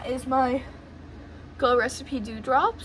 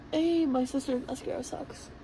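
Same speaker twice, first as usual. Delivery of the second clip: usual speaking voice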